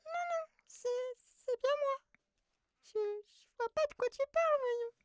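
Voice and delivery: very high, stammering borrowed voice. Acted. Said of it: "c'est pas ta voix".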